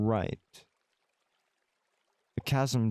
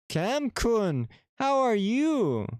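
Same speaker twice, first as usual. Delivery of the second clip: cutesy voice; 11 semitones higher, slower than usual. Acted. Greeting friend in put-on voice.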